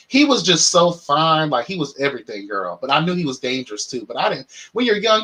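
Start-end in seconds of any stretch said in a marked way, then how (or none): none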